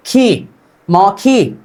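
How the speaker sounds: खी then मौखी said slowly, syllable by syllable; slow pronunciation demo. Shown as a demonstration. Teacher to students, slowly sounding out मौखी. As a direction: spell out मौखी slowly for the class. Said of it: "ख plus ई".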